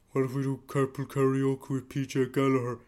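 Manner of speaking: lightly mocking a salesperson's pitch; a hint of a dim sales guy pitching; clueless salesman. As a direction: toss out the idea like a smug ad-man. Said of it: "will say stuff like".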